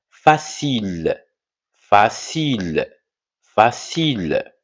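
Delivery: "Facile" drawn out slowly, ending on l; silent final E. Shown as a demonstration. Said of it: "E ne se prononce pas". (« facile » lentement, e final muet)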